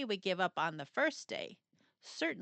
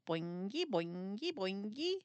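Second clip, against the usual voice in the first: softer, springy rising boings; bouncy sound effect; 6 dB quieter than usual. Acted. Narrator voicing gear bouncing free, soft springy boings. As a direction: bounce each word up like a spring.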